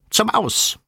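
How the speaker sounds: very high, squawky cockerel voice; high-pitched farmyard squawk; cackling rooster. A narrator's reading. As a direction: cluck it out like an agitated rooster. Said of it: "gackerte Ken der Gockel".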